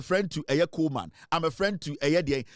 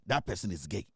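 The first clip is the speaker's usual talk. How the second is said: muttered aside; 11 semitones lower, 8 dB quieter than usual. Real, not acted.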